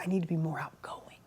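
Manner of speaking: retold shy whisper, voiceless and soft. Shy guy at the mastermind whispering his wish. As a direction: whisper it like a shy man admitting a need. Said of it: "he's whispering".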